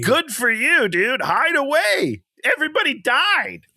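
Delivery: high-pitched sarcastic congratulations; sarcastic, worked-up high rant; mock outrage. Real, not acted. Mocking the character who hid.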